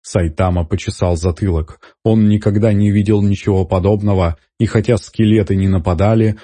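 ordinary delivery, nothing marked

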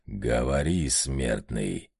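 низкое снисходительное «смертный»; свысока, низким голосом демона; снисходительно. A narrator's reading. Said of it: "милостливо кивнул ему одержимый". (снисходительный низкий голос одержимого)

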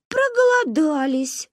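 high, slow bleating chorus. Acted. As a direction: bleat it out meekly. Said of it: "проблеяли мы дружно".

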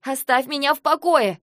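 high, loud protest; angry shout. Acted. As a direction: shout to be left alone. Sister shouts at brother grabbing her. (сердитый крик протеста)

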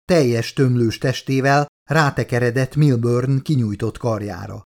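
plain reading voice

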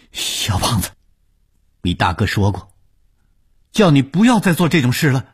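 high, building to a loud cry; desperate plea. Acted. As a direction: plead with him, rising to a panicked shout. Horrified captive begs him to stop. (惊恐地哀求，越喊越高)

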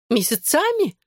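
lightly voiced reproach, leaping high; high-pitched, reproachful question. A narrator's reading. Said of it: "с упрёком бросила она". (с упрёком, высоким голосом)

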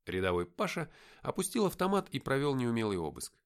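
ordinary delivery

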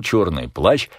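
ordinary delivery, nothing marked

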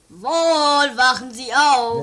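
loud wake-up call; calling loudly. Acted. Detective calls loudly to wake Wall. Waking a sleeping man loudly.

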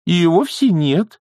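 high, slow nanny voice; placating objection. A narrator's reading. Nanny defends young witch, objecting in high voice. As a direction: object slowly to keep the peace. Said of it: "возразила нянюшка, пытаясь сохранить хрупкий мир".

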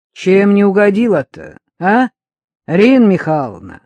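громко, с вызовом, выше обычного; наступай на собеседницу с обидой; задетый вызов. A narrator's reading. Любка задета, идёт на ссору. (задиристо, громко)

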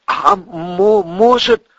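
high, hesitant stuttered question; nervous, tentative stutter; timid stammer. Acted. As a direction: trip over the word as you object. Stammerer timidly objects to the plan.

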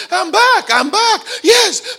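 high, loud, laughing cry of release; joyful shouts. Retold or imitated. Pastor retelling a healed psychiatrist's joy, high excited shouts. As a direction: shout it like a man just set free.

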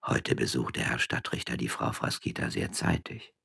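hushed, low voice among peasants; low murmur. A narrator's reading. Peasants murmuring to each other, hushed and low. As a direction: murmur it quietly, half hidden. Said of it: "mit leiser Stimme zueinander".

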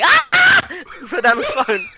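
high yelp collapsing into laughter; laughing through the words; laughing shriek. Acted. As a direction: scream in mock horror, then crack up. Acts screaming reaction, breaking into laughter.

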